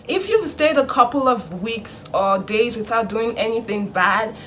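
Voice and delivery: usual speaking voice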